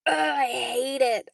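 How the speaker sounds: whiny being tickled. Retold or imitated. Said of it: "I get really, like".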